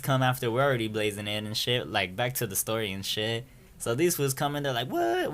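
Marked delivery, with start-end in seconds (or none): 4.9-5.3 a high, startled yelp; mock shocked squeak; incredulous what. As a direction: pitch leaps up, caught off guard. Homies see the other group.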